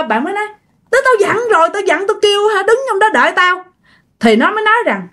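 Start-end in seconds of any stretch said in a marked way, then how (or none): 0.9-3.6 high-pitched, mildly indignant retort; indignant protest. Acted. Woman reminds friends she told them to wait, high indignant protest. Acted quarrel with friends who left.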